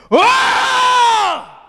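sudden, high, full-throated war shout; battle cry. Shown as a demonstration. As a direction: let out a terrified battle roar. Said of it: "I'm telling you what kind of a shout it is".

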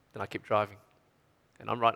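normal talking voice

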